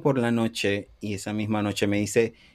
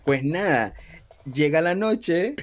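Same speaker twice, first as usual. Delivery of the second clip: ordinary talk, nothing marked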